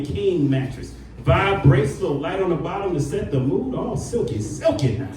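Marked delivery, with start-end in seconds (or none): none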